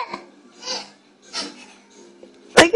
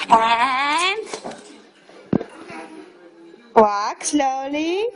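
slow, drawn out; 3 semitones lower, 4 dB quieter, much slower than usual. Real, not acted. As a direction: stretch the words out slowly. Coaxing baby to walk slowly.

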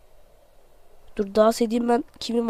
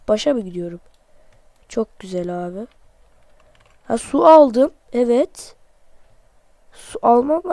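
normal talking voice

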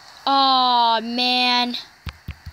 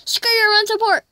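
mocking pitcher; 8 semitones higher, 4 dB louder than usual. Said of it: "He's like".

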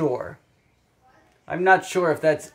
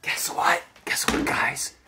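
a playful secret whisper; hushed "guess what, guys"; 3 semitones lower than usual. Acted. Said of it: "secret whisper to the kids".

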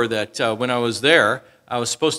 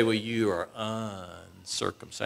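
mock sneer; 6 semitones lower, 13 dB quieter, slower than usual. Sneering insult from outsiders.

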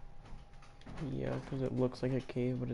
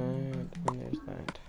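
stretched out slowly; slow drawn-out; much slower than usual. Real, not acted. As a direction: drag each word out. Drawn out final item.

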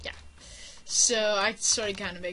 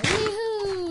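imitated whoop; 12 semitones higher, 7 dB louder than usual. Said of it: "ran out ... going".

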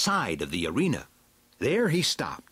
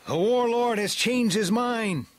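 very high, raised announcement; crying out; 12 semitones higher, 4 dB louder than usual. A narrator's reading. Leader of the riders to Beastman's party, crying out. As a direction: shout the news out high across a distance. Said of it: "cried the leader".

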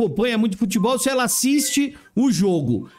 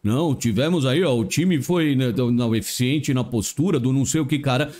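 low, evasive coach-speak voice; Rogério Ceni; 10 semitones lower than usual. Retold or imitated. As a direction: lower pitch, slick press-conference talk. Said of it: "os discursos do Rogério Ceni".

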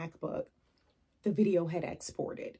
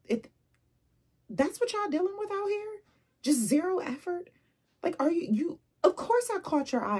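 high, loud, incredulous scolding; incredulous indignation; 8 semitones higher, 5 dB louder than usual. Acted. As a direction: take him to task in high-pitched disbelief. Acted rebuke of the man's pickup line.

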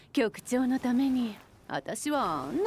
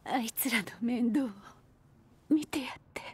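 hushed unvoiced plea; whisper; 2 semitones lower, 5 dB quieter, faster than usual. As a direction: whisper it like a last favour. Quiet parting request. (ささやくように)

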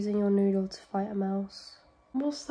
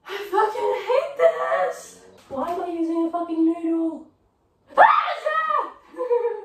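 high, loud panicked wail; freaked out; 8 semitones higher, 6 dB louder than usual. Real, not acted. Fighting a mouse with a noodle, freaked out. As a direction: cry out in panic, rising to a shriek. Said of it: "Why did I get so freaked out".

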